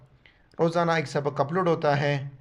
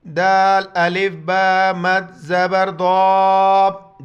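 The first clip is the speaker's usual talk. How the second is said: loud, drawn-out teacher spelling; spelling drill; 3 semitones higher, 8 dB louder, much slower than usual. Shown as a demonstration. Teacher to students, loud spelling drill. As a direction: call each letter out to the class. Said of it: "اب اس کو جا کریں گے".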